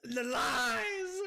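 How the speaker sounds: loud mock outrage, laughing; laughing scream. Real, not acted. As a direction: wail it, cracking up. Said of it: "That scream just made Summer jump".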